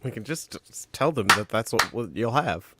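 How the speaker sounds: usual speaking voice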